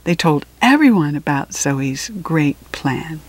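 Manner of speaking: usual speaking voice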